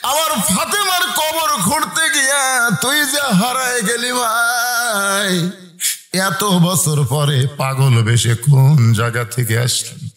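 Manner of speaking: impassioned sing-song preaching; melodic sermon storytelling. A narrator's reading. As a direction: tell it as a chanting preacher, stretching line endings. Preacher tells story in chant.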